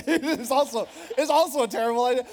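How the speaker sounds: real laugh bubbling into the words; laughing through words. Real, not acted. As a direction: crack up while owning a bad idea. Laughing at own app idea.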